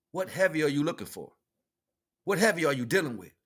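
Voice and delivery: ordinary talk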